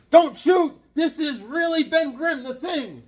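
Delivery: loud, high frantic plea; panicked hero. Acted. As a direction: beg them not to shoot. Voicing comic book dialogue.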